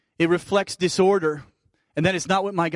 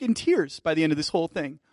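normal talking voice

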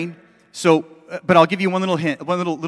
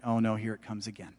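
quiet dread; 6 semitones lower, 12 dB quieter than usual. Retold or imitated. Retold dread as anxiety arrives.

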